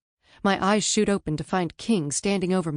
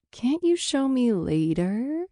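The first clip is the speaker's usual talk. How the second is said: suggestive plea; 7 semitones higher, slower than usual. Acted. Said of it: "wagging my eyebrows suggestively".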